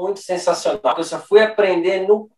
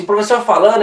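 normal talking voice

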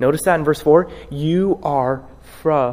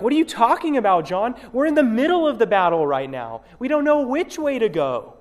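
worried believers; 7 semitones higher than usual. Retold or imitated. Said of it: "would be like".

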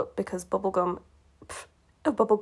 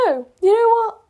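much higher, louder burst; excited outburst; 13 semitones higher, 8 dB louder than usual. Real, not acted. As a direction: blurt it out in delight.

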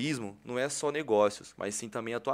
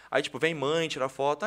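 ordinary talk, nothing marked

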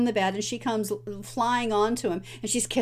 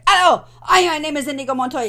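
much higher and louder, rushing fast; revived rallying cry; 6 semitones higher, 8 dB louder, faster than usual. Retold or imitated. Inigo revives against Count Rogan, loud fast rallying cry. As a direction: fling the name out like a battle cry. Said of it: "he perks up".

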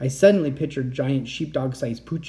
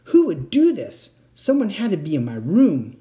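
angry; 4 semitones higher, 6 dB louder than usual. A narrator's reading. Girl to her friend over the smashed phone, angry. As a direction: demand answers, furious. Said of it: "My anger burned".

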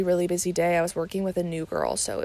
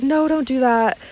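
higher and louder quoted plea; retold friendly protest; 6 semitones higher, 8 dB louder than usual. Kind tipper to tearful server, retold friendly protest. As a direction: imitate a customer protesting, lifted voice.

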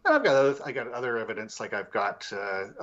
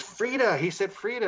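much higher, startled mimicry; imitated excited woman; 10 semitones higher than usual. Investigator retells woman hearing her name, imitated excitement. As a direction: mimic her amazement in a high-pitched voice.

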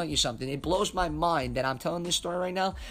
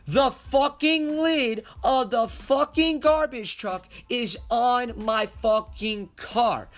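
outraged yell; 7 semitones higher, 5 dB louder, slower than usual. Retold or imitated. Outraged retelling of the crash.

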